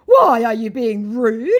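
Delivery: high, loud, drawn-out indignation; mock an outraged scammer, slowly; incensed scammer. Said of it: "said my incensed and triggered scammer".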